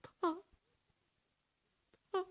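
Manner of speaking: high, broken sobbing words. Acted. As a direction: choke on the words and cry. Daughter breaks down crying. (哽咽抽泣)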